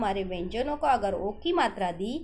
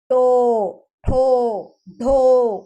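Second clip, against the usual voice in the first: टो ठो ढो each called out loud and held; loud syllable drill; 9 dB louder, much slower than usual. Shown as a demonstration. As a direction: belt the sounds out for the class. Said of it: "उसी में हमें समझ आ रहा है".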